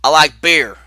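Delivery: loud cowboy yell, retold; imitated yell.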